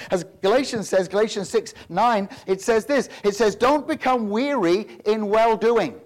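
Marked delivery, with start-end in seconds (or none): 3.5-6.0 slow, loud read of the verse; stately scripture quote. Shown as a demonstration. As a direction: savour each word of the King James line. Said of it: "I love that phrasing".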